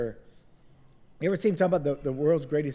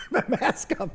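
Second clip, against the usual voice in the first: laughing; 8 semitones higher than usual. Retold or imitated.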